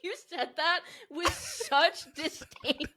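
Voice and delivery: talking through laughter; laughing. Real, not acted. Host cracking up at her cohost's tone, laughing. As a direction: laugh while getting the words out.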